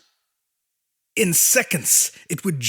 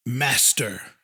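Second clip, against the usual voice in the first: low, reverent monster voice, a little lower; awed monster servant; 6 semitones lower than usual. A narrator's reading. Abomination kneels before its creator, low and awed. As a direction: breathe it out in trembling worship. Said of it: "shuddering in ecstasy".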